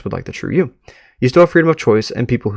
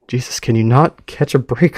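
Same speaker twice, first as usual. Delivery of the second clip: normal talking voice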